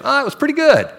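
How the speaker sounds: high, offhand "pretty good", unimpressed; lukewarm shrug. Retold or imitated. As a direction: brush it off like it was only okay. Group member reports lukewarm worship.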